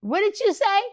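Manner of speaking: high-pitched retold disbelief; mildly acted, taken aback, pitch jumping up; startled question. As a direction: ask it high, as if startled awake. Startled at a voice in retelling.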